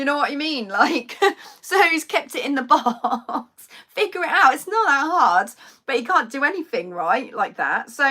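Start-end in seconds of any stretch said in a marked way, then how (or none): 1.6-5.5 higher, louder, laughing in exasperation; exasperated laughing. Real, not acted. Vlogger laughing at her dad leaving things boxed, exasperated. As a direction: laugh it off, fed up with him. Mocking dad's helplessness, laughing.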